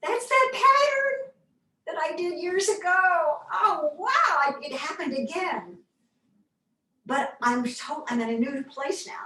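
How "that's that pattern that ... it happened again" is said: surprised recognition. Retold or imitated. Catching her old thought pattern, high and amazed. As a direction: squeal it out like a happy discovery. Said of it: "I got to where I was going".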